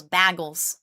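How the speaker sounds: BAGELS said as BAG-uls; short A, as in bag, in BAGELS; imitated weird bagel. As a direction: put "bag" at the front of BAGELS. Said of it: "people say bagel weird".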